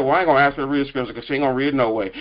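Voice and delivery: gossiping church member. Retold or imitated. Preacher voicing a churchgoer refusing to ask her, low rapid grumble. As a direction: rattle it off low like an old complaint.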